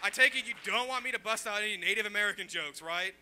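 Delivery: loud, incredulous complaint; mock indignation. Comic to a balking crowd, loud mock indignation. Keeps scolding the audience.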